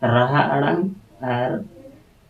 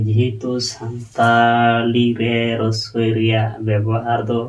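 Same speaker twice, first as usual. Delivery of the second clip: slow teaching voice; much slower than usual. Shown as a demonstration. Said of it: "explaining vowel use slowly".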